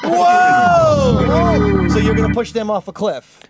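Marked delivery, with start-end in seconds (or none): 0.0-1.9 high, loud startled shout; loud shocked whoa. Real, not acted. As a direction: react like it's outrageous. Shocked reaction.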